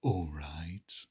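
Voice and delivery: very low, soft cat voice; low whispered creature voice; storybook cat. A narrator's reading. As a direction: drop right down, quiet and whispery. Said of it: "said the cat".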